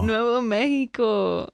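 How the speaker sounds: Nuevo Mexico slow and loud, neh-vo meh-hi-co; Spanish accent. Shown as a demonstration. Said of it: "your Spanish accent".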